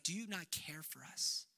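hushed plea. Retold or imitated. Disciples voiced waking Jesus.

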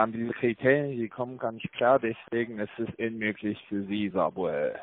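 usual speaking voice

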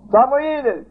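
high, loud repeated call; God's voice calling Samuel a second time; God calling again. Retold or imitated. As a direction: call the name twice across the church. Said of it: "și-l strige Dumnezeu pe copil".

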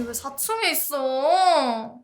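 ordinary talk

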